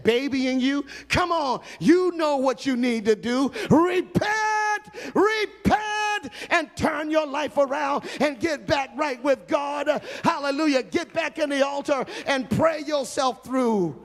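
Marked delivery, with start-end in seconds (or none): 3.7-6.3 shouted call to repent. Real, not acted. Preacher urging the lost to return, full shout. 6.5-14.0 high, half-sung preaching over music; preaching at full force. Real, not acted. Preacher calling sinners back to the altar, chanted.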